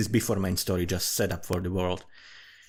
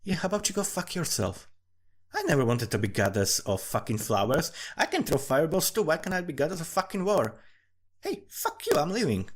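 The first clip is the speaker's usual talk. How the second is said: mock defiant goddess; 6 semitones higher than usual. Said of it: "was like".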